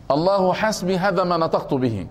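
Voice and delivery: ordinary talk, nothing marked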